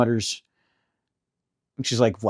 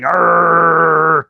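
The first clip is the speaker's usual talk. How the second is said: frustrated growl; 3 semitones higher, 4 dB louder than usual. Retold or imitated. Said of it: "I'm like".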